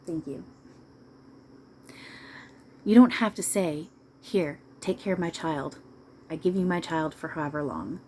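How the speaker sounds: ordinary talk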